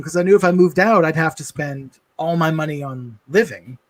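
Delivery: ordinary talk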